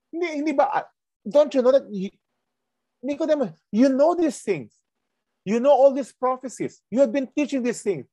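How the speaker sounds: high, earnest, chiding voice; Jesus gently rebuking a learned Pharisee, high-pitched; voicing Jesus. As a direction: press him like a teacher who expects better. Preacher voicing Jesus rebuking Nicodemus.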